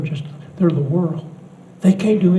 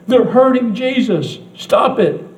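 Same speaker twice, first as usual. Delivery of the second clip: put-on upset child; 6 semitones higher than usual. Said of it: "child protesting to grandparent".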